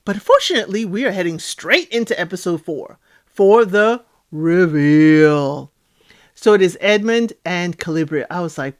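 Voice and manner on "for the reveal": slow, drawn-out announcer build-up; dramatic announcing. Real, not acted. Host heading into episode four, dramatic announcing. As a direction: stretch it out like a big show announcement.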